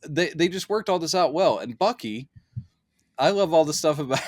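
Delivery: ordinary delivery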